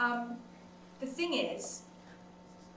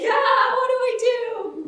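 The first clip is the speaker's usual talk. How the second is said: loud, high mock panic; high-pitched, hammed-up helpless yell; panicked cry; 11 semitones higher, 8 dB louder, slower than usual. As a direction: act out a panicked wail. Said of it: "you're like".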